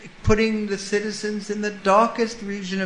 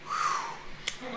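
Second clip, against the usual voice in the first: relieved whew; 11 dB quieter than usual. Retold or imitated. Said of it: "the goat behind thinks".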